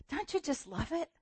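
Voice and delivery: ordinary delivery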